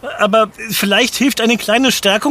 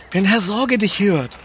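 ordinary delivery

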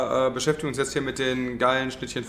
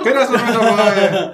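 laughing; 8 semitones higher, 7 dB louder than usual. Real, not acted. Laughing announcement after the break.

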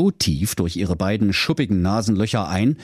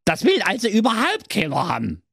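high, cheeky dwarf voice; squeaky little dwarf complaining; 11 semitones higher than usual. Acted. Dwarf quote in fairy tale. (hohe, freche Zwergenstimme)

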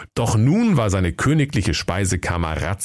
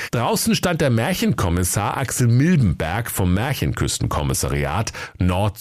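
ordinary delivery, nothing marked

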